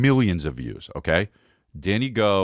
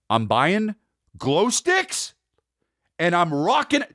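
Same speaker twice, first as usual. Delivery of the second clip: excited; 11 semitones higher, slower than usual. Real, not acted. Hyped about the Danny Go show.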